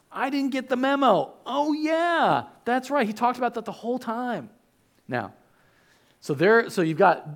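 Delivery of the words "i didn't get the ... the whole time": higher, dopey mock voice; clueless disciple. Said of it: "you're like".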